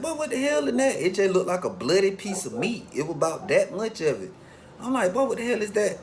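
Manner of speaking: shocked, voice jumps way up at the gory sight; shocked disbelief. Retold or imitated. Sees bloody piece of meat.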